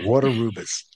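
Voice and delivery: Waterubas with an R ending "water" and starting the next syllable; linking R in Waterubas. Shown as a demonstration. Interviewer showing the corrected name, Waterubas with both Rs sounded. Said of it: "it ends with an R and begins with an R".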